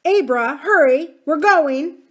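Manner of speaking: loud high call across the yard. A narrator's reading. Mother calling her daughter to leave, loud. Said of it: "Missus Bacon called".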